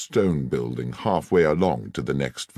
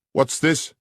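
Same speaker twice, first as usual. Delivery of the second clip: sharp cry; 10 semitones higher than usual. Acted. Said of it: "cried Holmes".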